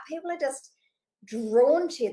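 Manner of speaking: ordinary talk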